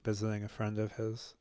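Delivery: ordinary talk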